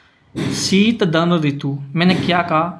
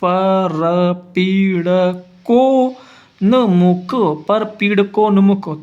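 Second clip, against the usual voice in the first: परपीड़को drawn out syllable by syllable, very slowly; teacher sounding out परपीड़को slowly; 2 semitones higher, much slower than usual. Shown as a demonstration. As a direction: stretch परपीड़को so students hear the ओ. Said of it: "ओ की मात्रा उस का के लंबे जोड़ देंगे".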